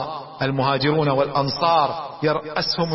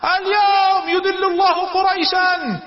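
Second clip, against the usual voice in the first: slow, much higher battle shout; war cry; 5 dB louder, slower than usual. Retold or imitated. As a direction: roar it to rouse the troops. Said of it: "سعد أول ما تحرك بجيشه قال".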